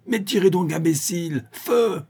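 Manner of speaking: high, exasperated command; furious yell. A narrator's reading. Vorsky berates his accomplice for not shooting, furious yell. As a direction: yell at a useless underling, pitched right up. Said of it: "hurla Borsky".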